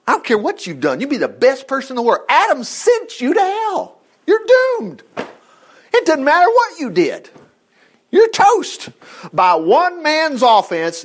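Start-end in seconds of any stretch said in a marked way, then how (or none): 2.3-8.9 fervent preaching. Real, not acted. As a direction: hammer the doom home to the congregation. Preacher drives home doom.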